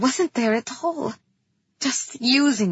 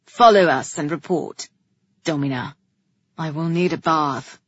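low, louder royal orders; commanding king; 6 semitones lower, 5 dB louder than usual. A narrator's reading. King ordering brother and hostess, commanding and low.

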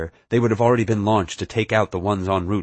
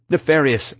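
higher, louder urgent plea; imploring raised voice; pleading; 7 semitones higher, 5 dB louder than usual. A narrator's reading. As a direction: call the name out, pleading. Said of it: "I pleaded".

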